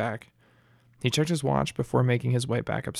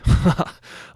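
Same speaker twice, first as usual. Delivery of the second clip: breathy chuckle, mostly air; a quick amused huff of laughter; short airy laugh; 6 dB louder than usual. Acted. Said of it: "Jeff laughed".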